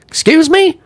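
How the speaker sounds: high, loud Marine challenge; sudden high-pitched indignant bark; Marine coming out. Retold or imitated. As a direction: re-enact a Marine squaring up to someone. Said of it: "I saw the Marine come out of him".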